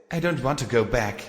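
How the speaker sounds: ordinary delivery, nothing marked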